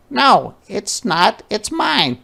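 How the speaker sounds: high, slow stubborn no; a child insisting it's hers; high-pitched stubborn little girl; girl's indignant denial. Acted. Ruthie quarrel.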